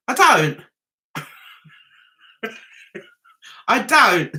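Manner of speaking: ordinary delivery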